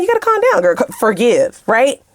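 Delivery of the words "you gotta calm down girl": high self-soothing aside; telling herself to calm. Real, not acted. Reader to herself mid-rant, high wry self-calming. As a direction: talk yourself down, high and wry. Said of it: "Let me calm down".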